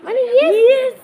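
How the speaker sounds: high, loud shocked yelp. Real, not acted. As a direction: blurt it in shock. Shocked by a yes.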